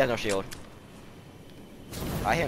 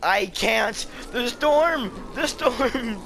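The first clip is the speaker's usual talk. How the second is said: panicked; 11 semitones higher, much slower than usual. Real, not acted. Player caught by the storm, panicked. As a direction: cry it out in rising alarm.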